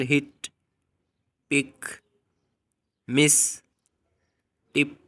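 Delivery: हिट, पिक, मिस, टिप with a short i as in hit; teacher demo of short i in हिट and पिक; short i words. Shown as a demonstration. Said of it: "For example".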